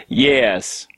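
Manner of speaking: ordinary delivery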